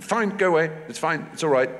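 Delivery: eager dismissal. Retold or imitated. Director cutting a perfect audition short, eagerly dismissive.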